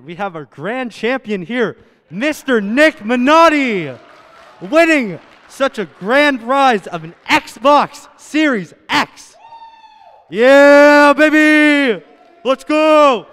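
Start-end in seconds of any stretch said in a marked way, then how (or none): 2.1-9.3 slow, loud, higher announcer boom; booming prize announcer. Acted. Announcing the grand champion prize. 10.3-13.2 loud, high wild cheer; excited cheering. Real, not acted.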